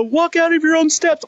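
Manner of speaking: very high-pitched imitated screamed orders; retold screaming. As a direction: yell urgent instructions like a panicked sergeant. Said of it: "screaming instructions at me".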